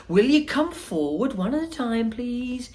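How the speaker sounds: high, fluty host's call; fluty Wonka. Acted. Wonka calling the children forward, high and fluty. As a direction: call them forward brightly. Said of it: "His voice was high and fluty".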